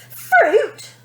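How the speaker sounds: high, loud outraged question; a spoilt girl outraged at the word fruit; indignant. A narrator's reading. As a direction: spit it back in disbelief. Tantrum building.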